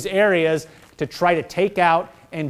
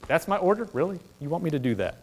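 hushed reluctant soldier; 3 semitones lower, 9 dB quieter, faster than usual. Retold or imitated. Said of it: "we say this and go".

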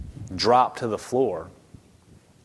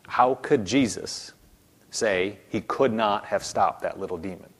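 normal talking voice

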